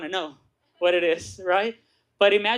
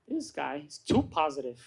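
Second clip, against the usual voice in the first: low, muted grumble of disbelief; acted sour aside, pitched down; negative; 5 semitones lower, 5 dB quieter than usual. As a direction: grumble it like a sceptic. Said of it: "I was so negative".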